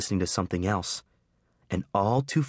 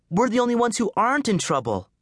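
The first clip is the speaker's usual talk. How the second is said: much higher insistent argument; arguing; 13 semitones higher than usual. Acted. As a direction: push back hard at him. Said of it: "she argued".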